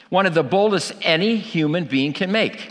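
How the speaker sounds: usual speaking voice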